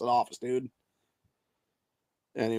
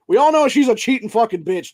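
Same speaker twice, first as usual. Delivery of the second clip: louder, higher angry rant; angry outburst; 6 semitones higher, 5 dB louder than usual. Real, not acted. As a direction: spit the insult out in disgust. Ranting about the congresswoman.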